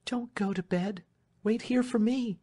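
high, urgent request; mildly hurried. Acted. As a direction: press her to stay, pitch high, without rushing much. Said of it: "said Marian hurriedly".

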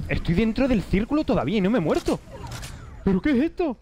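1 disbelief. Real, not acted. Dies inside the safe circle.

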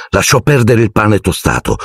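ordinary delivery

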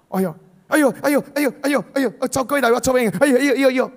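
high, frantic fretting groans; panicky bystander. Acted. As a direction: whine like someone who can't cope. Acting a panicky useless bystander.

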